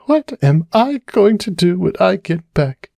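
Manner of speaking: high, lost, questioning; Cupcake wondering aloud. Acted. Cupcake wondering aloud at the bar, high and lost. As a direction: ask the air what to do. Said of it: "she wondered aloud".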